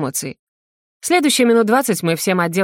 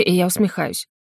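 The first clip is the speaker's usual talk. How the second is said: ordinary delivery, nothing marked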